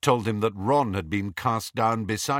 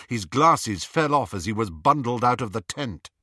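usual speaking voice

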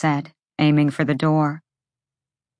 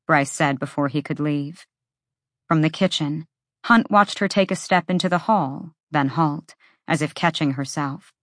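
ordinary delivery, nothing marked

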